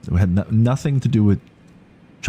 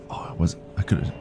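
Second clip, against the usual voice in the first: whisper; 6 dB quieter, faster than usual. Real, not acted. Whispering while playing the game.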